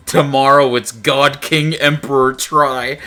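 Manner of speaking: slow, grand mock decree; grandiose proclamation. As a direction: proclaim it like a coronation.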